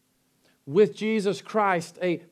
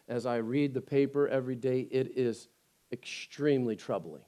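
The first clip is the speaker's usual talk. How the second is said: quiet sincere preaching; 6 semitones lower, 7 dB quieter than usual. Acted. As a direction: draw them in gently, almost confiding. Quiet reflective sermon stretch.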